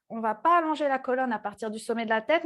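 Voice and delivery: ordinary delivery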